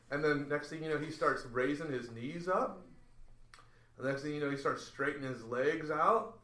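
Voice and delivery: ordinary delivery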